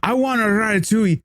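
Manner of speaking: put-on high-pitched drunk-guy whine; mock drunk. Drunk stranger wanting the toy, lightly mocked whine. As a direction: imitate a tipsy man begging. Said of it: "He sounds drunk as fuck".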